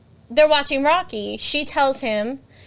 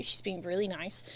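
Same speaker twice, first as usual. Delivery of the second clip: quiet aside; 5 semitones lower, 14 dB quieter than usual. Real, not acted. Aside after quote.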